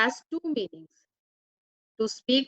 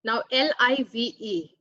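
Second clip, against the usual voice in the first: ordinary talk, nothing marked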